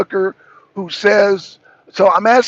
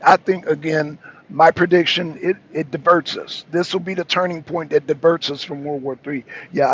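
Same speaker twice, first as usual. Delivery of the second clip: normal talking voice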